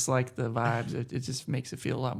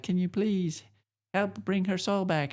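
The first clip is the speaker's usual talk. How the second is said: higher pleading voice; pleading young hero; 6 semitones higher than usual. Retold or imitated. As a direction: beg like an earnest young hero. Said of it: "the Wander's like".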